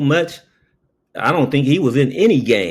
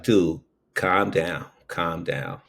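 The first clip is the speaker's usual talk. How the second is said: slow, much softer "calm down"; soothing; 6 semitones lower, 6 dB quieter, slower than usual. Retold or imitated. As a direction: gently talk the crying robber down. Soothing the crying robber.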